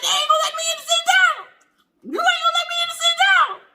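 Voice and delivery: high, fast, loud mock wail; frantic back-seat plea. Stuck in the back seat, shrill excited plea. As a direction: plead like a frantic fan locked out.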